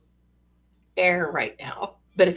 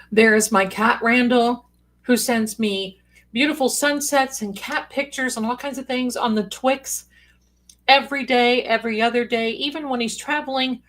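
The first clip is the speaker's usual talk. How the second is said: normal talking voice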